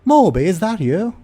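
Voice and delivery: high, loud mother calling out; excited high female character voice; woman's voice. Acted. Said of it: "And the woman say".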